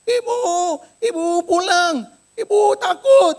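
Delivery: high, loud terrified wail; mock frightened crying. Maid phoning her employer to come home, tearful panic. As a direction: imitate a maid wailing in fear. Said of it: "nangis-nangis, ketakutan".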